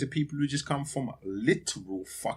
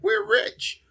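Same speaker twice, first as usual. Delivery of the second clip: mock rich people; 5 dB louder than usual.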